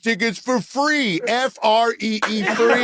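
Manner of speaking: bragging celebrity. Pretend. Voices a celebrity bandwagon fan.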